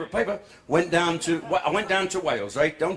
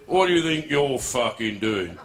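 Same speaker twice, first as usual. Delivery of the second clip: low sergeant-major policeman voice; RSM policeman; 5 semitones lower than usual. Acted. Policeman confronts man bathing in the Thames, sergeant-major voice. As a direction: challenge him like a regimental sergeant major. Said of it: "Used to be an R S M".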